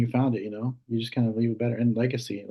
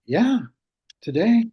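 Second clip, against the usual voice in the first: mock-cheerful; 11 semitones higher, 6 dB louder, slower than usual. Nurse mock-cheerful to patient.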